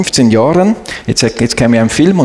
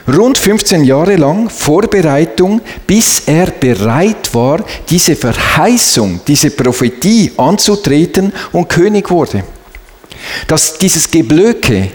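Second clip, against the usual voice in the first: ordinary talk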